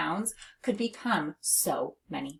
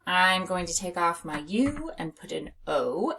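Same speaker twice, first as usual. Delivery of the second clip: usual speaking voice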